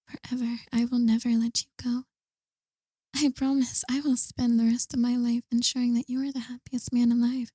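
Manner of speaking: hushed, intimate roleplay whisper, partly breathy. Acted. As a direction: murmur close to the mic like a tender lover. ASMR roleplay to a lover.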